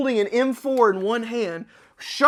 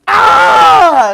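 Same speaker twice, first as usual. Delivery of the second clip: short loud battle yell; distant yell; 10 semitones higher, 16 dB louder than usual. Retold or imitated. Player yelling while storming in, loud shout. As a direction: yell out as if charging.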